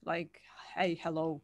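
breathy whisper showing the H. Shown as a demonstration. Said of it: "this is a h sound".

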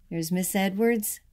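usual speaking voice

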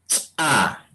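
"Cha" with a sharp popped ch, a tiny gap, then the vowel; popped ejective ch. Shown as a demonstration. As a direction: pop the ch of "Cha" apart from the a. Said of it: "se pronuncia así".

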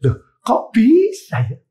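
amazed. Retold or imitated. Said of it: "saya gini".

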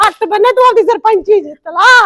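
loud grief-stricken scolding; angry crying rant. Acted. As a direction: lash out at the husband through tears. Wife blames husband, crying.

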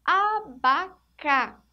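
Abaca stretched very slowly, a-ba-ca each syllable drawn out. Shown as a demonstration. Teacher building the word abacaxi for pupils, very slow. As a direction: spell it out in slow motion. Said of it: "vem o B e o A".

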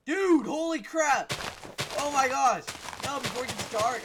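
loud, high exclamations of shock; alarmed yelling. Real, not acted. Player racing the dark in game, yelled in alarm. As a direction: shout in real dismay.

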